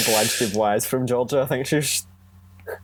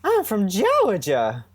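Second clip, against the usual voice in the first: high, slow Southern belle drawl; Southern belle accent, pitched well up and stretched out; 9 semitones higher, much slower than usual. Acted. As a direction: channel a Blanche Dubois Southern lady, much higher and slow. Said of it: "I do a really good Georgia accent".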